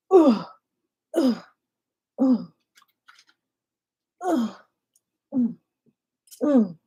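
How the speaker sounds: loud grunts with each punch; effort grunts. Real, not acted. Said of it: "Let out some noises".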